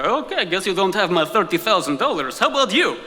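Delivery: put-on Russian hustler talking in accented English, pitch swooping up. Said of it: "switch to English when they realize".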